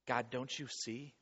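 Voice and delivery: soft, slow, low question to God; subdued plea. Acted. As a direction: plead quietly, with drawn-out words. Voices the waiting heart's prayer.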